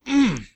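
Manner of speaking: high, satisfied chef's "Mm"; mock tasting hum. Imitating cooking show hosts: a high tasting hum. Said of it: "they go".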